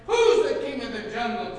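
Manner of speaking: very loud, high lion roar; booming, bullying demand; roared and snarled. A narrator's reading. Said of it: "roared and snarled out the question".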